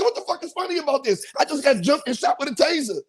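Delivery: high, indignant demand; indignant outburst. Retold or imitated. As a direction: snap at her laughing. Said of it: "I said".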